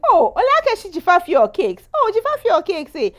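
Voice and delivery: high loud mock gossip. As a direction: tease like a nosy neighbour. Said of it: "as opposed to in the beginning".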